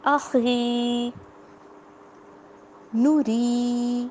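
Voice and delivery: آخری and نوری with the long vowels stretched slowly; drawn out vowels. Shown as a demonstration. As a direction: stretch each long vowel like Quran recitation. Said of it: "اس کی ہم exercise کرتے ہیں".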